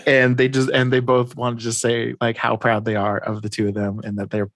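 ordinary talk, nothing marked

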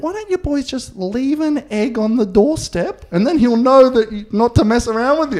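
hammed-up sweet mother suggesting; mum's voice. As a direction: coax the boys like a gentle mum. Mum voiced giving advice.

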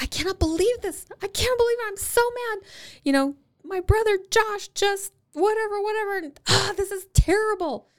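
high, loud upset rant; upset kid. Retold or imitated. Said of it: "They're like".

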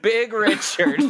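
high, gleeful repeat; delighted squeal. Real, not acted. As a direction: squeal it with glee. Echoes the pun, squealing.

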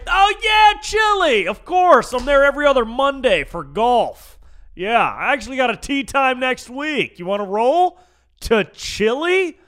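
loud, high sarcastic bragging; mock enthusiasm. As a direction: play the jet-setter, hammed-up and loud. Sarcastic imagined reply about golfing there.

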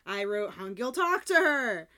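loud, frustrated fan outburst; playful shouted demand; exasperated plea. Retold or imitated. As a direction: yell at the character on screen. Viewer's exasperated plea at character.

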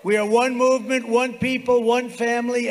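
high, loud rally chant; roaring rallying speech. Real, not acted. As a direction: hammer each phrase home. Rally chant, rising list.

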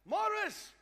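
high, loud retold cry of a name; imitated scream. Preacher voices a dying wife seeing her husband, imitated scream. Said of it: "a mom started screaming".

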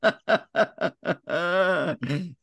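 high, rolling laugh; real laughter. Real, not acted. Host laughing at guest's blown-up podcast quip, high laughter.

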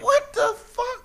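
high, slow stunned cry; shocked. Real, not acted. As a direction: react in total disbelief. Shocked reaction.

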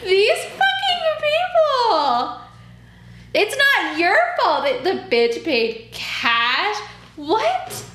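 very high, loud, slow shout; outraged shout. Real, not acted. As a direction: explode in disbelief. Peak outrage at client.

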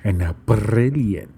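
"brilliant" with a trilled rolled R, slow. Shown as a demonstration. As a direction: roll the R in "brilliant" hard. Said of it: "Do you like how I roll my R's".

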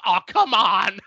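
fed-up complaint, thrown out loud; a real burst of exasperation; exasperated groan. Retold or imitated. Breaker's exasperated quote.

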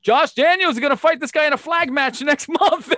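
high, loud mock ring announcer; laughing mock ring announcer voice. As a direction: ham up the blowhard announcing it early. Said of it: "Firehawk is in the ring like".

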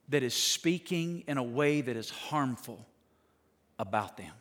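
soft, low preaching with measured pauses; hushed, solemn. Real, not acted. Preacher warning about harmful words on a brother, hushed. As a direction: bring it down low and let it sink in.

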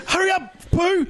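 high, teasing hurry-up; mock hurry. Mocking his mate as Pooh Bear, high teasing hurry. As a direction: needle him to hurry.

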